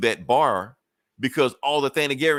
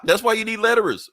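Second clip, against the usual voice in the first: amused laughing aside; 12 semitones higher, 5 dB louder than usual. Real, not acted. Joking aside while laughing.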